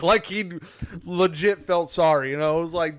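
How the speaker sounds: ordinary talk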